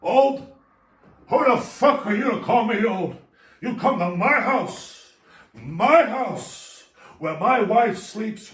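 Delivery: outraged, loud, high movie-gangster rant; Al Pacino. Acted. Patient insulted at being called old, hammed-up loud outrage. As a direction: explode in offense, stretching the key words. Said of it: "Mister Al Pacino".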